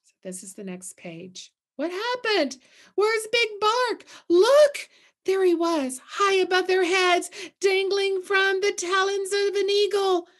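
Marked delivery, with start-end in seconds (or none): 1.8-10.3 much higher and louder, eager storytelling; excited reveal. A narrator's reading. Teacher reading the eagle page to listeners, excited and high. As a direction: point up at the sky in wonder.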